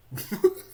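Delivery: short high burst of laughter; a quick bright laugh at himself; a short real laugh. Real, not acted.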